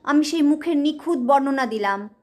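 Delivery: usual speaking voice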